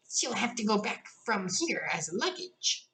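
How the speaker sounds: a fussy little beetle, drawn out. A narrator's reading. Beetle weighing in on Alice, slow and fussy. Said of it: "he went on with".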